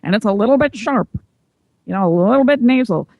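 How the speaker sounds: mock forties radio voice; old-movie voice. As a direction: put on that old-time movie talk. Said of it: "they're talking like this".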